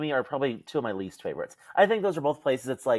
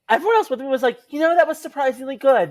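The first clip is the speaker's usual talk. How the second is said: excited retelling; 11 semitones higher, 8 dB louder, faster than usual. Retold or imitated. Retelling friends' verdict.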